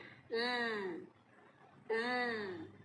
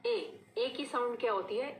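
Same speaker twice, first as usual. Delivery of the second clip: normal talking voice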